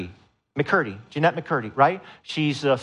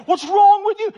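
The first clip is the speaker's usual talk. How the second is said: put-on much higher, louder exasperated shout at a baby; mock parent yell; 8 dB louder than usual. Frazzled parent yelling at crying baby, loud mock shout. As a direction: bark it like a frazzled parent losing patience. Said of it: "you never yell".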